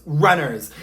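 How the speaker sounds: ordinary talk, nothing marked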